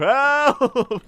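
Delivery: high, loud sudden yell; drawn-out parody cry for help; mock scream. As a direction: burst into a comic scream mid-talk. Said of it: "he just starts screaming".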